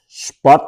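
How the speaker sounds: "Spot." với âm t cuối bật rõ; nói "Spot." bật rõ âm t cuối, giọng hơi cao; clear final t. Shown as a demonstration. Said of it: "Phải có chữ thờ ngoài sau". (phát âm "Spot." bật rõ t cuối)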